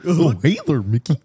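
lightly voiced goofy cartoon dog; a hint of a dopey cartoon greeting; showing off the Goofy voice he learned, lightly done. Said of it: "learned the goofy one".

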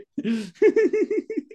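real high giggling laugh; high burst of laughter. Real, not acted. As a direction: laugh high at the punchline coming. Laughs at own story.

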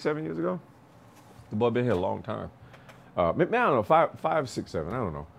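ordinary talk, nothing marked

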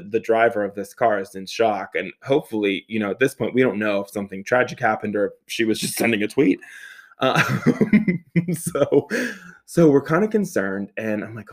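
ordinary talk

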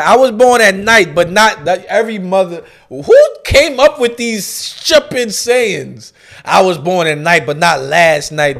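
loud, exasperated mom imitation; scolding mother. Mother catching her child trying to fool her, loud scolding. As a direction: punch out the saying like a fed-up mother. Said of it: "This is what my mother used to tell me".

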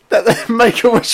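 laughing. Real, not acted. Friend teasing, cracking up.

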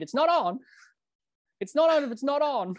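high-pitched imitation of an umpire; mock umpire's refusal. Acted umpire over stump mics.

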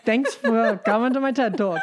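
amused fake speaker's thank-you, laugh in the voice; laughing mock sign-off. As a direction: sign off a joke lecture through laughter.

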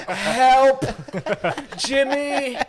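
high, loud re-enacted cry for help; screaming for help. Man in pain calling his housemate, acted scream. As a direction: call out loudly as if to another room. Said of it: "screaming in pain, hoping that someone would fucking hear me".

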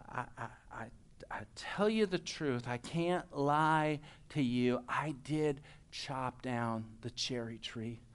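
put-on reluctant child confessing, voice dropped; solemn confession. Young George confessing to his father.

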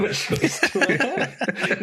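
laughing as he repeats it; laughing echo. Real, not acted. Co-host repeats the line back to his partner, laughing. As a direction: giggle through the echoed question.

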